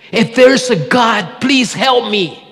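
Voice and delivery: high, slow, desperate last plea; a dangling man's final prayer; pleading. Retold or imitated. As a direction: beg with the last strength left.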